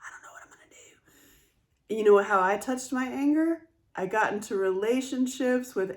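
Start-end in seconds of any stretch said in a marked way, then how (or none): 0.0-1.9 fast, unvoiced joking whisper; hushed, rushed playful whisper. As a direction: drop to a whisper like it's a secret threat. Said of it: "I think I'll just stay quiet".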